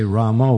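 Ramo ending in "oh", lifted at the end; Ramo pronunciation. Shown as a demonstration. Showing the mistaken chant, Ramo with an O. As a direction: turn the end of Ramo into a long O. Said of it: "we don't know where it's going".

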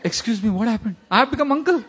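high-pitched comic disbelief; hammed-up incredulous outburst; mock shock. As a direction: squeak it out as if deeply affronted. Acts out his shock at being uncle.

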